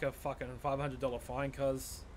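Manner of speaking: usual speaking voice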